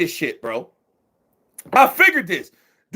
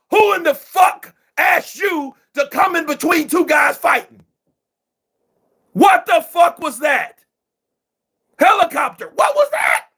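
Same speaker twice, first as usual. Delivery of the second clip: angry shouting; 8 semitones higher than usual. Real, not acted. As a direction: blast the referee, disbelieving. Ranting at ref stopping action.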